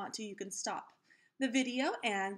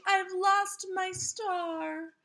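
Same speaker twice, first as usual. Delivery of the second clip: mock dismay; 9 semitones higher, 5 dB louder, much slower than usual. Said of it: "exclaimed Keisha".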